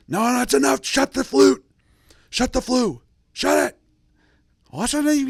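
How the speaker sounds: very high, loud hammed-up shout; father yelling at his son in the rice paddy, hammed-up high shout; mock yelling. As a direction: bark the orders way up high and loud. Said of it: "just yelling in Mandarin".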